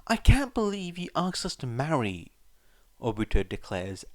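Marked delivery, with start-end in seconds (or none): 0.1-2.3 outraged. Acted.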